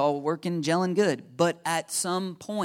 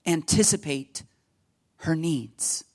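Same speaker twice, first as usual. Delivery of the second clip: put-on amateurish British accent on each word; bad British accent; slower than usual. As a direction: put on a poor English accent. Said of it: "my really bad British accent".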